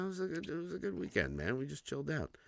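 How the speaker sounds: ordinary talk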